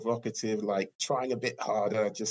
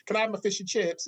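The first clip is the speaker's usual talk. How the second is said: mock British tourist; 10 semitones higher than usual. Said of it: "British tourists go away and".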